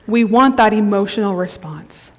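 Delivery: ordinary talk, nothing marked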